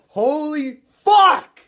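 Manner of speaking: very high, loud, drawn-out scream; ecstatic scream. Real, not acted. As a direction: let it rip in sheer disbelief. Stunned after the title win.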